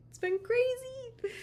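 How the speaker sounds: a viewer exclaiming to Liv in a high, loud gush; excited viewer voice. Pretend. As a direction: play a thrilled viewer reporting back. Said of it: "and tell me".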